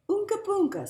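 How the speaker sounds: put-on high, loud baby talk; mother voice. A narrator's reading. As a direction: coo at the baby like mom. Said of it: "said his mother".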